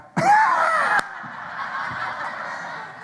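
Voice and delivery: put-on mischievous child laughing; high cackle. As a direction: cackle like a cheeky toddler. Child's cheeky laugh imitated.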